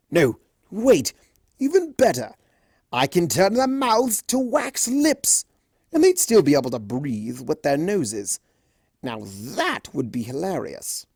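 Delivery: high, playful chaos spirit; delighted with his idea; gleeful trickster. Acted. Discord gleeful.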